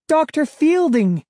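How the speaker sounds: high, loud startled cry; surprised cry. Acted. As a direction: call out the name in shock. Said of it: "Sari cried".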